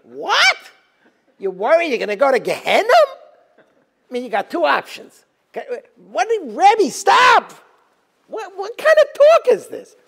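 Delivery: high, loud, half-laughing outburst; incredulous protest. Acted. Student tells his dying Rebbe not to worry, incredulous protest. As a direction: cry out in hammed-up disbelief at your teacher. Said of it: "I would have said".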